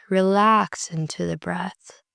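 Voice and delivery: normal talking voice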